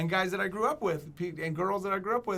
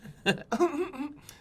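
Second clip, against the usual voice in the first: a shrill put-on laugh, much higher; high mock cackle; 10 semitones higher than usual. The fan imitated laughing, high put-on cackle. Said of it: "Why are you making that noise".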